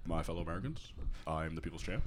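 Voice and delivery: low, soft presidential address; The Rock as Obama. Acted. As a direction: drop deep and quiet like a mock president. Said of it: "the Rock Obama".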